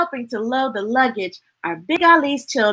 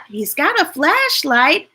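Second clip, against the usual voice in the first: ordinary delivery, nothing marked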